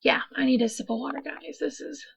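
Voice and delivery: quick breathy whisper; whispered aside. Real, not acted. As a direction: whisper it fast, off mic. Whispered aside before drinking.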